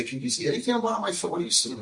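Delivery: high, very fast imitation; mock eager questioner. Someone asking the rabbi to read their foot, mock eager. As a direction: rattle it off high, like a gullible follower.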